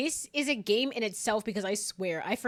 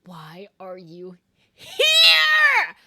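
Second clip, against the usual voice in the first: exasperated outburst; 13 dB louder, much slower than usual. Pretend. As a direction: stretch the last word into a loud shriek. Exasperated outburst at game character.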